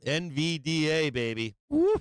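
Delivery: ordinary talk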